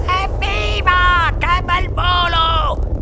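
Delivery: high, drawn-out whooping; thrilled grandfather's high cry; shouted cheer. A narrator's reading. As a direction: whoop with delight at the ride. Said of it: "gridò ancora nonno Joe".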